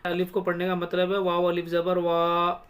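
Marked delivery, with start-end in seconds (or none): 2.0-2.7 "वा" held out as a long vowel; stretched वा. Shown as a demonstration. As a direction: draw "वा" out long. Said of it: "वाव को खींचना". ("वा" को लंबा खींचकर)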